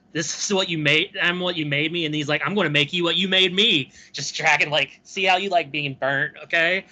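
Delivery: hammed-up Vader. Vader dragging his former master through fire, hammed-up menace. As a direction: snarl it like a vengeful Vader.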